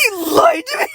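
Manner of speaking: hammed-up sob-yell; mock tearful shout. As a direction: wail it in mock hurt.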